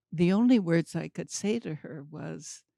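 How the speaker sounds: ordinary talk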